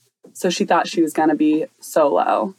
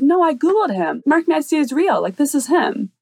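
lightly voiced mom, higher pitch; retold protest, pitched up to insist; imitating insistent mom; 8 semitones higher than usual. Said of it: "And his mom's like".

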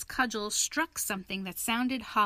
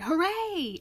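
high cheer; high, gleeful hooray; excited cry; 9 semitones higher, 4 dB louder than usual. A narrator's reading. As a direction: cry out at a sudden find. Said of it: "the rat cried".

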